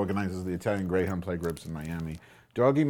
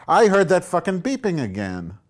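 loud, high, irritated yell; fed-up shout at the computer; annoyed outburst; 9 semitones higher, 9 dB louder than usual. Real, not acted. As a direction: snap at the beeping noise.

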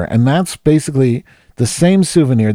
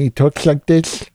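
creepy guy impression; 7 semitones higher, slower than usual. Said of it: "so creepy sounding".

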